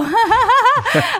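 a loud, high burst of laughter. Real, not acted. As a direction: laugh out at herself. Laughs at own voice. (risada alta e aguda)

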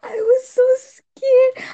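high, loud and slow, reliving the fright; high-pitched excited squeal; excited scared squeal. Real, not acted. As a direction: squeal it like the scare is happening now.

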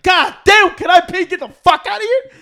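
loud, high exasperated shout; retold impatient outburst. Wanting to pay and leave the store, exasperated shout. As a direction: yell it in comic exasperation.

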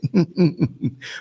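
light, quick chuckling; teasing chuckle. Real, not acted. Host teasing a viewer about seeing an accent, amused chuckle. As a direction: chuckle at someone's slip of the tongue.

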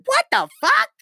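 very high, squeaky disbelief; mock outrage. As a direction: shoot way up high in hammed-up shock. His acted reaction to the punchline.

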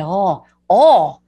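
long round Minnesota vowels; put-on, drawn-out Minnesota accent. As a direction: mimic an empathetic Minnesotan listener. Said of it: "'cause they're doing that".

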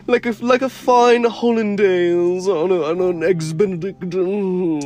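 high, drawn-out mock gourmet savouring; hammed-up relish. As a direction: relish it slowly in a high, loud voice. Hammed-up relish over fancy food.

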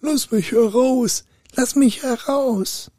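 high, drawn-out plaintive call; high trapped-spirit cry; muffled trapped spirit. A narrator's reading. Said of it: "mit so einem recht dumpfen Ton rief".

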